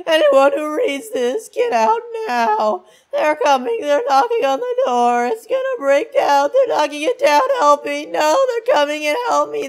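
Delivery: very high, loud, drawn-out wail; hammed-up terror, wailing each sentence; panicked reading. Acts out a panicked written note.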